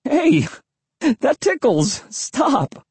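very high, tickled squeal; high, drawn-out, giggly-sounding plea; laughing protest. A narrator's reading. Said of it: "I was laughing".

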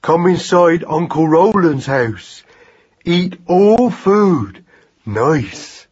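put-on slow, heavy toddler-style effort; excited baby talk. A narrator's reading. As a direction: labour out each word like a hungry infant.

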